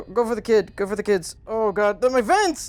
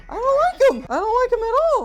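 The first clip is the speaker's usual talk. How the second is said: real fear, pitched way up; frightened whine; faster than usual. Real, not acted. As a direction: whimper it out, spooked. Scared of the figure.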